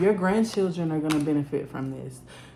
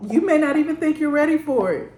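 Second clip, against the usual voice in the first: excited burst; 9 semitones higher, 6 dB louder, faster than usual. Real, not acted. Emotional reading peaks.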